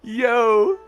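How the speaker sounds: usual speaking voice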